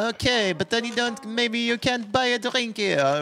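high, slow put-on barmaid voice; bartender voice. Bartender refuses the broke man, high and slow. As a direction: turn him down in a high, sweet barmaid voice. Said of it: "Hon var tydligen också utrikesjobbare där".

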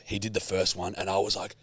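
ordinary talk, nothing marked

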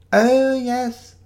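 put-on high, loud, quavery old vicar. Shiloh posing as a vicar admires the tree, quavery. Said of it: "said Shiloh in a quavery voice".

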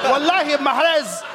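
put-on Arabic accent. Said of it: "keep talking in the Arabic accent".

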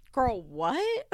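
half-laughed shocked mom; mom's disbelief. Retold or imitated. Mom reacting to Kayla, laughing disbelief. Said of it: "Her mom is like".

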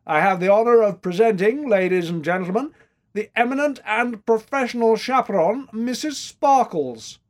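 loud, high, pompous introduction; booming master of ceremonies, slowing on the name; grand announcement. A narrator's reading. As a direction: present her to the room with ceremony. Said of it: "he announced".

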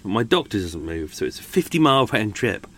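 ordinary talk, nothing marked

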